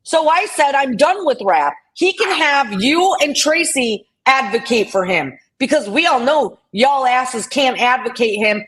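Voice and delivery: angry rant at an accuser in chat; furious confrontation. Real, not acted. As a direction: tear into her point by point. Confronting Southern Girl over theft claim.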